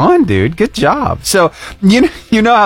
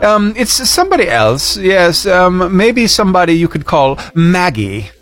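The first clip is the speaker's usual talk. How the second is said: put-on British accent. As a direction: let the caller down in mock-British. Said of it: "I was able to hold it that long".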